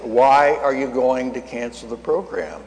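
slow, drawn-out questioning; voice of God. Retold or imitated. God asks why he would cancel, slow and deliberate. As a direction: stretch the question out with weighty patience. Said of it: "God spoke to me and said".

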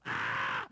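loud breathless scream of fright; scared scream. Retold or imitated. Big Mike screaming as the goat rears at the truck. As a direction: shriek as the goat charges. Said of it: "Mike go".

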